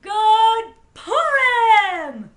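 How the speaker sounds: loud, high, long-held greeting; exuberant holiday shout. A narrator's reading. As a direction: belt it out and stretch each word. Pinchas greets the shopkeepers.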